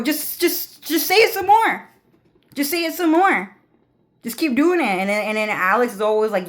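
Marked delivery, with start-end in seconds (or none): 0.0-4.8 very high, loud, insistent mock voice; imitating Lucifer. Lucifer urging Sasuke to say more, high and insistent. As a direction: egg them on in a high, loud put-on voice. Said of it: "Lucifer's to go".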